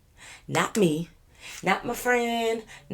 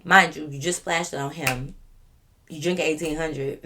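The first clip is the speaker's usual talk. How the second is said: ordinary talk, nothing marked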